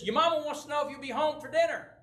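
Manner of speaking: high, quick dad voice; dad calling over. Retold or imitated. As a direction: imitate a father announcing his errand. Dad at the door, covering.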